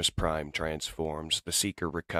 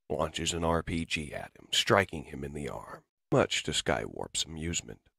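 ordinary delivery, nothing marked

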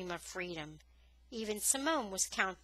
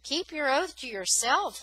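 warning; 6 semitones higher, 5 dB louder than usual. A narrator's reading. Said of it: "a man warned".

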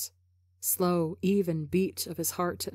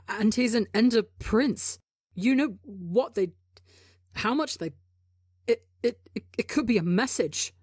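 higher, tumbling over his words; panicked stammer; 4 semitones higher than usual. A narrator's reading. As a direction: spill it out in a frightened rush. Tubbo stumbling in panic.

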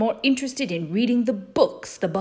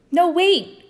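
high, loud plea to stop; alarmed cry; 10 semitones higher, 5 dB louder than usual. Acted. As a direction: cry out in sudden protest. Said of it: "cried Henry".

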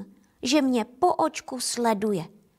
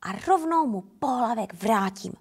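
ordinary delivery, nothing marked

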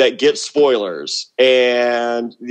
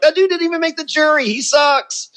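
high, fast dismissive sneer; mock scornful. To casting, mocking Boston Rob in a high sneer. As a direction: scoff at him in a put-on voice. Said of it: "I'm like".

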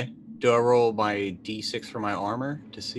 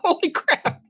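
much higher, loud yelp of disbelief; shocked exclamation; 5 dB louder, slower than usual. Real, not acted. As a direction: blurt it out at the roll. Shocked at a dice roll.

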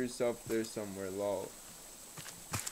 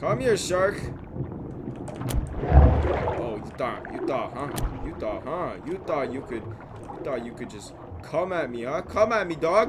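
loud, high, jeering shouts at the shark; taunting yell; 8 semitones higher, 12 dB louder than usual. Real, not acted. Gamer yelling taunts at the shark he's fighting, loud and high. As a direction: bait the shark, yelling come at me.

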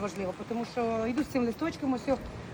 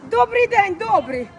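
loud high greeting called across the street; a cheerful greeting called out loud; calling out; 11 semitones higher, 11 dB louder, slower than usual. Real, not acted. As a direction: call hello to someone far away. Greeting someone far off.

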